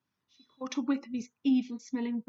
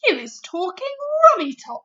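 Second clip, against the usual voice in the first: louder, higher, bolder retort; braver; 7 semitones higher, 11 dB louder than usual. A narrator's reading. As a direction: stand up to the bully. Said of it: "growing braver by the second".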